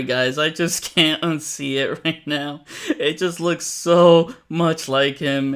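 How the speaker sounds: ordinary talk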